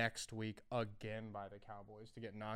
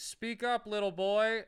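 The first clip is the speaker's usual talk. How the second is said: mocking officiant; 9 semitones higher, 6 dB louder, slower than usual. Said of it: "they'd be like".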